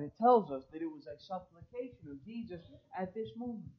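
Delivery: ordinary delivery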